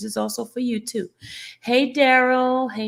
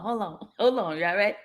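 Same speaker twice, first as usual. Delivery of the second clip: ordinary delivery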